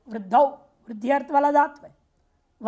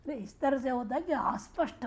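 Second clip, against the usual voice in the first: normal talking voice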